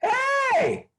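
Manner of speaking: high excited "Hey!" shouted an octave up; delighted greeting. Retold or imitated. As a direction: answer as if thrilled to hear a friend. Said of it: "the voice always goes up an octave".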